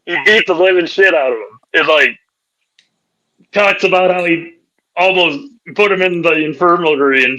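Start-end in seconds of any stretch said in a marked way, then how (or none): none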